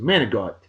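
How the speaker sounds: "manigot" clipped the local way, like "MAN-ih-got"; Northeast manigot. Shown as a demonstration. Host telling listeners the Northeast way to say it: "manigot", clipped. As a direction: say "manigot" as Northeast slang, soft g, dropped final vowel. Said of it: "we call it up in the Northeast".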